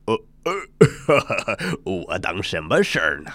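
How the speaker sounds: regal chuckle, making light of the request; chuckling Dragon King. Acted. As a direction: laugh it off like an old king. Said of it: "龙王坐在宝座上".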